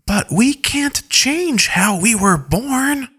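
high, slow, plaintive character voice; plaintive innocent. Acted. Rot protesting the insult to Derek, plaintive and high. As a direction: plead slowly up high, like a wounded innocent.

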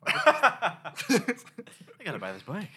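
slow, laughing, high fan voice; laughing gush. Retold or imitated. Mocking brainwashed customer gush.

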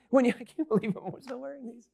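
breaking into a laugh mid-sentence; laughing at himself. Real, not acted. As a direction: laugh at your own silly glasses. Laughs at himself wearing glasses.

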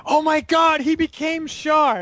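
high, loud mock amazement; hammed-up astonished crowd reaction; awestruck exclamation. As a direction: cry out like a stunned onlooker, drawing out the end. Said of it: "And it's like".

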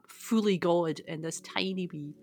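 ordinary talk, nothing marked

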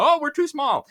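high, whiny mock of the scared crowd; squeaky mocking whine; mock fearful whine.

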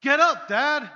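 put-on high, loud kids' wake-up shout. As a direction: yell it to wake dad up. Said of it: "they'd say".

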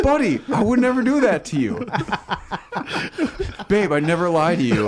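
high-pitched innocent protest; mock innocence. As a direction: swear innocence with exaggerated sincerity. Mock-innocent assurances to prank victims.

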